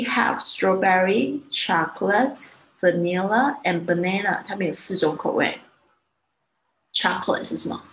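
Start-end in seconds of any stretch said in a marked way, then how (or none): 0.1-4.4 slow, each flavor drawn out; slow teacher's list of flavors. Shown as a demonstration. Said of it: "他说". (慢慢地念出每种口味)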